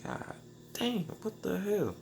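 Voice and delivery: high, strained yelp of disbelief; disbelieving outburst. Retold or imitated. Retelling his reaction to the breathalyzer, in high disbelief. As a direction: squeak it out like you can't believe the reading. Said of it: "I'm like".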